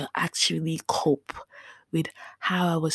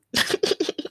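high breathy laugh; 13 semitones higher than usual. Real, not acted. Laughing at her own despair.

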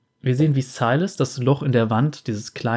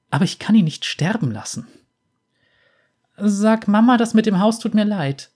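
put-on torn young woman; 5 semitones higher than usual. Lux torn, resolute.

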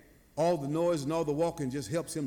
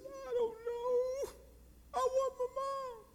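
very high, slow, soft whine; mock tearful child, drawn out and tiny; imitated crying; 7 dB quieter than usual. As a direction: wail like a lost little boy wanting his mother. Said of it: "And he's crying".

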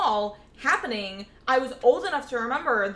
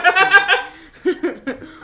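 loud laugh; 8 semitones higher, 12 dB louder than usual. Real, not acted. Big burst of laughter.

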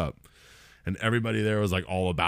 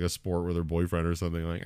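ordinary talk, nothing marked

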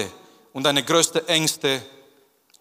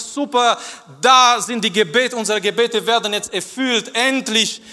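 high, excited mock joy; mock delight; 6 semitones higher, 3 dB louder than usual. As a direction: gush like prayers were answered. Imagined joyful reaction, mocked. (gespielt überschwängliche Freude)

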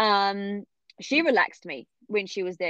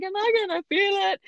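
much higher, fast worried question; anxious; 12 semitones higher, faster than usual. Retold or imitated. Mother to the obstetrician about the episiotomy, high and anxious. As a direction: panicky quick ask.

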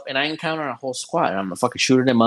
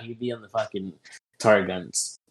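normal talking voice

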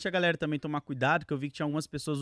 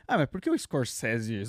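mock critic voice; 6 semitones lower, 4 dB quieter, slower than usual. Mocking a film critic question.

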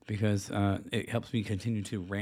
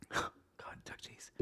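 gasped whisper; 16 dB quieter than usual. Real, not acted. Gasp of disgust.

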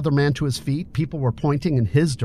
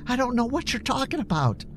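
much higher, softer plea; a frightened young man begging; pleading; 11 semitones higher, 5 dB quieter than usual. A narrator's reading. Billy pleading with his attacker, high and soft. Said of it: "Billy pleaded".

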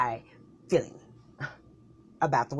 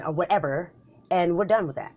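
ordinary talk

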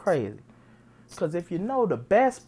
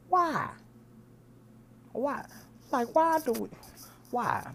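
high pleading why, shaking his head; exasperated why; 8 semitones higher than usual. Real, not acted. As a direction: ask why in high disbelief.

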